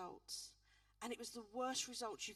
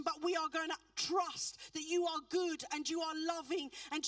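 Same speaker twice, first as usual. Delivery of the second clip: loud, higher, impassioned preaching; preaching at full force; 4 semitones higher, 8 dB louder than usual. Acted. Minister urging the congregation to trust God, loud preaching. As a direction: build to a shouting altar call.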